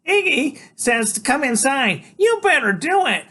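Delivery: high, loud brassy woman. A narrator's reading. Loretta speaks.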